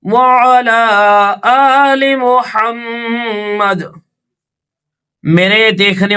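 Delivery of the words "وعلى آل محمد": slow, drawn-out chanted durood; slow recited blessing. Acted. Teacher opens the lesson to viewers with a slow chanted blessing. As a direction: intone each word slowly and reverently.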